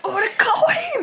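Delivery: high, loud squeal of delight; squealing boy in drag. Retold or imitated. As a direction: squeal it like a boy thrilled in a sailor uniform. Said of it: "みたいな感じで". (文化祭で女装した男子の「俺かわいい」の甲高い叫び)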